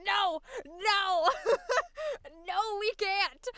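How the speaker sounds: high, loud, laughing no no no; laughing protest. Retold or imitated. Answering a friend who says anyone can do old voices, laughing protest. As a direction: laugh through a desperate denial. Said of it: "I'm like".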